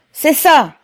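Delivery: high, loud exclamation; approving cry. A narrator's reading. Rybin backs Pavel's speech, crying out high and loud. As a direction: shout your agreement from the crowd. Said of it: "s'écria Rybin".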